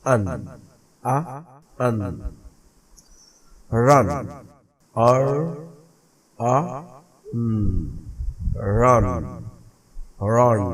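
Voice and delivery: रन split into आर, आ, न then said whole; teacher sounding out रन; sounded out word. Shown as a demonstration. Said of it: "teacher segmenting word रन".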